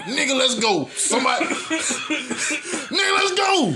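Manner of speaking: high laughing panicked shout; giggling mock panic; laughing imitation. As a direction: quote the friend through laughter, urgent. Imitating hurt friend.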